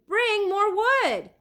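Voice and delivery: loud, high, slow shout. A narrator's reading. Leonardo calls to the helpers for fuel, loud shout. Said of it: "Leonardo shouted".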